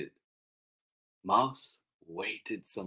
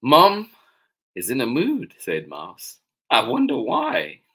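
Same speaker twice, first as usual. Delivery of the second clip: put-on loud, lifted storybook child voice; puzzled Mouse; 3 semitones higher, 8 dB louder than usual. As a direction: wonder it aloud, raised and lively. Mouse's remark, first reading.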